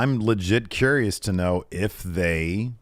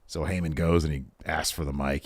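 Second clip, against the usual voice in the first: ordinary talk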